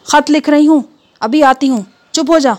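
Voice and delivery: wife calling out while busy writing a letter; calling loudly. Acted. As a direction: shout over the noise to the child. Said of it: "بیوی زور سے بولی".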